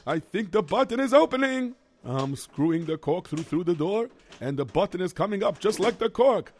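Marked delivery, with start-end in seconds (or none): 0.1-1.7 building excitement as it works; excited. Acted. Lock button rising.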